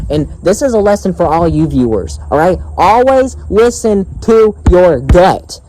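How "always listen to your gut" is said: slow, higher, word-by-word insistence; emphatic advice. Real, not acted. Vlogger giving viewers a lesson, slow and emphatic. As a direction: punch each word of "Always listen to your gut".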